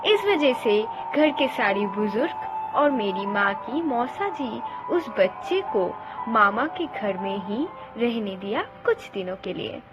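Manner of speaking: plain reading voice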